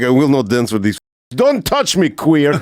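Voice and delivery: high, exaggerated Russian-accented outburst; mocking Russian accent. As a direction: make fun of an indignant Russian soldier. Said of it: "I'd always make fun of him".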